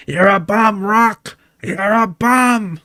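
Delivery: high-pitched, drawn-out mock jeer; imitated heckler. Streamer voicing what they hear while stumbling, high mock heckle. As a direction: jeer it high, stretching out the last word. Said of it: "I'm hearing as I stumble my way through this".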